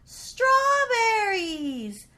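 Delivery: ordinary delivery, nothing marked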